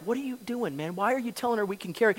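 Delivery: a quick under-the-breath aside so she can't hear; soft hurried mock whisper between friends; hushed aside. Monk's aside to his friend.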